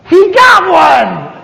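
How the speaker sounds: high, slow, gleeful "He got one!"; excited thought. Retold or imitated. Narrator's mock glee as a man walks to the altar. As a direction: savour it like a hunter scoring. Thinks excitedly as someone comes forward.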